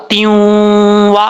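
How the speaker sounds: त्यूं वा merged, the त्यूं nasalised into वा and held slowly; nasal ghunna merge. Shown as a demonstration. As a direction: hum the नून through into वा. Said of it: "इदगाम यरमलून का गुन्ना हो रहा है".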